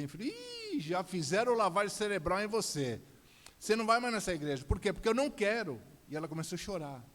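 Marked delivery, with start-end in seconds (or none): 0.7-5.8 loud, high, fast scolding; angry forbidding father. Retold or imitated. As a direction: lay down the law, raised voice. Acted quarrel, father forbids church.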